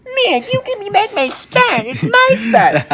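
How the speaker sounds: squeaky, drawn-out mock tantrum; hammed-up baby voice mocking himself; whiny little baby. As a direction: whine it very high like a toddler. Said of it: "I look like a little baby".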